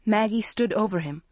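usual speaking voice

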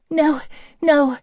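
put-on upset young woman voice; distraught young woman. Distraught Hannah refuses.

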